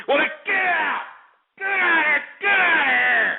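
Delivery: high, furious yelling; furious shout. Real, not acted. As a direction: scream them out of the room. Throwing a yawning chatter out.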